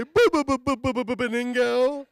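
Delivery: put-on high, loud little-kid answer; kid says his name. The imagined son proudly telling his name in a loud kid voice. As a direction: pipe it up proudly. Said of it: "What's your name, little guy?".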